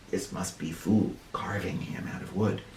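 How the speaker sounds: hushed comment on the picture; soft whispered aside. Real, not acted. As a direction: whisper it to the class.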